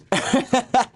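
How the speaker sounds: bright high chuckle after a tease; short high laugh. Real, not acted. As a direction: laugh off the joke lightly. Laughing at his own joke.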